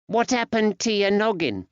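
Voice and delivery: put-on high-pitched young lad voice; little boy. A narrator's reading. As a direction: voice a curious small boy. Young boy's line in story.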